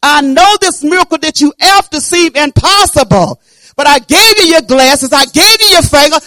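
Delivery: loud high shouted voice of God; voicing God. Preacher voicing God to the congregation, loud and high. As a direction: proclaim it like the Lord speaking through you. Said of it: "hold on to what I'm telling you".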